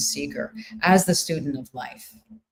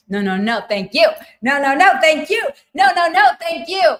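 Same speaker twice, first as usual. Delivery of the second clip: building higher and louder with each repeat; effusive thanks; 10 semitones higher, 7 dB louder than usual. Real, not acted. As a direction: escalate the thanks, high and loud. Playful escalating thanks to audience.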